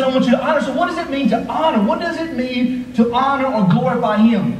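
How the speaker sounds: high, driving preacher cadence; full-force preaching. Acted. Pastor urging the church about the body, preaching at full force. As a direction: pastor pressing the congregation, preaching hard.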